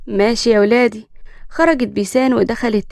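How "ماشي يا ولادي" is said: plain reading voice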